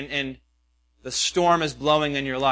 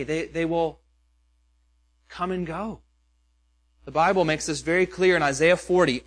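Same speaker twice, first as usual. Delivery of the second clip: ordinary talk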